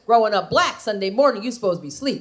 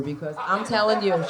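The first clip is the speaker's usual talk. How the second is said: ordinary delivery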